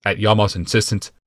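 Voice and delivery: usual speaking voice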